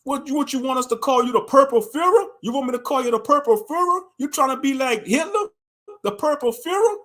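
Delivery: high, scornful taunting; mocking rant. Taunting the leader about the Purple Führer, high scornful mockery.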